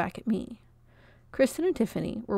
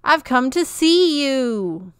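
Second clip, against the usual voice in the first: slow, higher and louder pleading; imploring plea; 5 semitones higher, 8 dB louder, much slower than usual. A narrator's reading. Peach pleading with Comfort at the back door, slow imploring. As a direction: plead with her, slowly. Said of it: "Peach implored".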